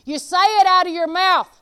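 loud, high preaching voice. Acted. Preacher stirring up the congregation, loud and fervent. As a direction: preach it out with full force.

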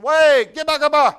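loud, urgent mock command; retold shouted order. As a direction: call the panicked child back sharply. Startled grandfather orders screaming child back.

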